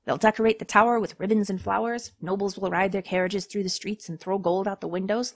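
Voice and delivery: ordinary talk, nothing marked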